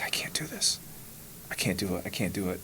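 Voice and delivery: hushed, broken murmur of giving up; tearful whisper. Retold or imitated. Exhausted runner whispering to himself that he's finished. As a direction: whisper it like you're about to quit. Retelling breakdown on the mountain.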